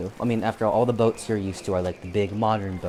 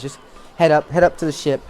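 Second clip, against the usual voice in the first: perky deer sailor; 6 semitones higher, 7 dB louder, faster than usual. Acted. Deer sailor gives directions.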